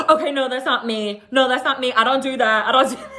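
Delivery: high, loud mock protest; mimicking Lisa. Imitating Lisa switching on Whitney, loud high protest. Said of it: "And Lisa switched".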